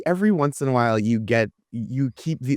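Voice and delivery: ordinary talk, nothing marked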